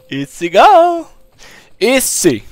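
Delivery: drawn-out high smug easy; cocky gloating. Real, not acted. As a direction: stretch it out, showing off. Cocky gloating after fight.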